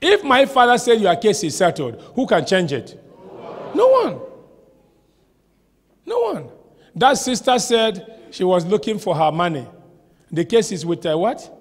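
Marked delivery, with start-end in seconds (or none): 3.7-6.5 emphatic high exclamation. Acted. Emphatic answer.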